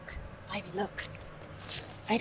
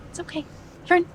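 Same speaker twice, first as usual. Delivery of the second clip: hushed, high reassurance, much softer; soothing whisper; 10 semitones higher, 16 dB quieter, faster than usual. Real, not acted. Owner hushing her nervous dog while she pees, soft high whisper. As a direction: barely breathe it to calm a nervous dog.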